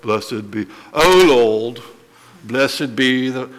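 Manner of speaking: mock dull, droning scripture reading; unexcited reading. Shown as a demonstration. Preacher shows the class how not to read it, mock dull. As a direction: read it with no excitement at all. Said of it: "not like how I just read it".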